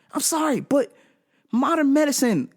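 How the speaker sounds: ordinary delivery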